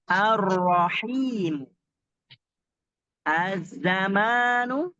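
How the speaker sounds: slow ar-Rahim, az-Zamanu with doubled consonants; drawn-out assimilated article; lam left silent in al-. Shown as a demonstration. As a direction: hold the r and z, no l. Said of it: "tapi dia tidak dibaca al".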